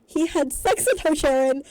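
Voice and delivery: loud, high, scandalized; shocked outburst to his wife. A narrator's reading. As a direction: blurt it out appalled. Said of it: "informs his wife in shock".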